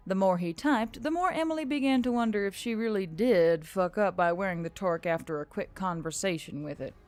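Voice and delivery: usual speaking voice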